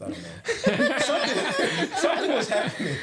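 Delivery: high, giggling through the words; can't stop laughing while telling it. Real, not acted. Telling friends an embarrassing teen story, laughing.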